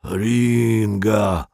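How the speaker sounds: put-on gruff voice, wheedling; rough, ingratiating plea. A narrator's reading. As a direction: beg ingratiatingly in a rough character voice. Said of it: "грубым басом заискивающе попросил". (грубо, но заискивающе)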